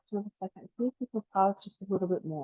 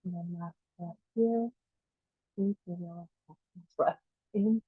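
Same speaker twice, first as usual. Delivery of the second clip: easing softer as each breath is let go; guided breathing meditation; 4 dB quieter than usual. Acted. Teacher counting down the last breaths, guided meditation.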